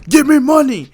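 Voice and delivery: loud, high-pitched shout; forceful shouted threat; mugger's demand. Acted. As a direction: yell it as a violent order. Mugger demands while kicking.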